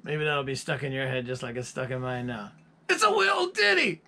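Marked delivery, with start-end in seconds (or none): 2.9-4.0 high, loud falsetto Scottish impression, "little ditty" squeezed to "wee-l dinny"; impression of a Scottish singer. Mimicking [name] mimicking the Outfield singer, shrill Scottish falsetto. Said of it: "impersonating the singer from the Outfield".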